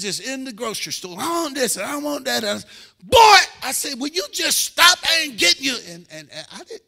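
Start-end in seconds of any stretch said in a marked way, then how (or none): 1.2-2.4 high, louder whiny-kid imitation; demanding child. Imitates demanding child in grocery store. 3.0-3.4 high, very loud shout; sharp, exploding reprimand; angry parental holler. Retold or imitated. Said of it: "it's how I said it". 4.0-5.8 loud, high exasperated scolding; fed-up parent shouting a refusal; angry parental holler. Retold or imitated. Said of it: "it's how I said it".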